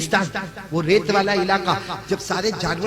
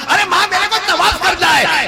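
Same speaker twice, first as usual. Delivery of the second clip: rising high, loud sermon chant; preaching at full force; 12 semitones higher, 7 dB louder than usual. Acted. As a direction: build it up to a cry. (जोश में बढ़ता हुआ was)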